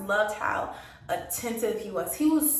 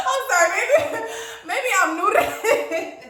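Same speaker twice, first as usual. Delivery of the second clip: high, laughing apology; 12 semitones higher, 4 dB louder than usual. Real, not acted. Apologizing to viewers while laughing. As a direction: giggle through the words. Laughing aside after the options.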